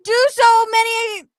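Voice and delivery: high, loud, hammed-up screaming read; screaming read with laughter breaking in; mock scream. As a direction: yell the comment like a crazed fan.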